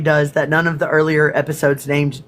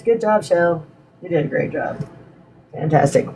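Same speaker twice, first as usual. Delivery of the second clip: sarcastic. Real, not acted. Scolding herself for the spill.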